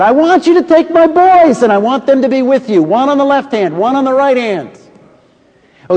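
put-on a doting mother bargaining for her boys.

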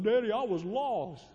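put-on a lost child blurting it out to his father; scared little boy.

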